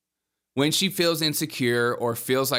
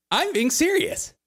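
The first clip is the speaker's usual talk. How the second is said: very high, exaggerated insistence; mock emphatic woman. Woman demanding that you believe her, mock emphatic and high. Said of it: "She talks like this".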